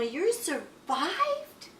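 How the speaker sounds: high, slow, drawn-out question; astonished cry. A narrator's reading. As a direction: stretch it out high in disbelief. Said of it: "cried Stella Luna".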